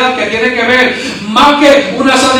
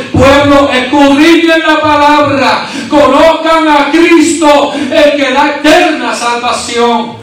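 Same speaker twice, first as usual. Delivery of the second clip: raised, ringing sermon delivery; impassioned preaching; 4 semitones higher, slower than usual. Acted. Preacher voicing God's call to the congregation, impassioned preaching. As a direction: proclaim it high, with drawn-out phrases.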